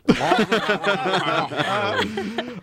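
high burst of laughter. Real, not acted. Laughing at muffled voice joke.

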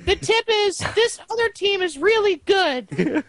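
very high, slow cartoon princess voice; Lumpy Space Princess. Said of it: "Was that the Lumpy Space Princess voice?".

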